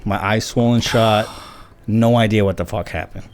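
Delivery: ordinary talk, nothing marked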